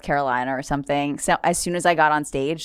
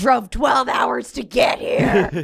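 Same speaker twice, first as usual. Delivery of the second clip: high, drawn-out mock yell; retold exasperated outburst; 6 semitones higher, slower than usual. Comic protesting to a heckler, retold exasperation. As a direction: wail the complaint slowly in mock outrage.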